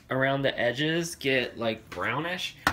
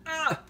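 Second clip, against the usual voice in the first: startled yelp; 13 semitones higher than usual. Real, not acted. Nearly burned.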